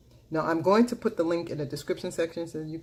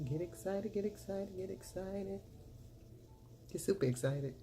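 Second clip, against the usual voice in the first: much softer, half to herself while working; soft catchphrase; 4 semitones higher, 8 dB quieter than usual. Acted. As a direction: murmur the catchphrase under the makeup work. Catchphrase said softly.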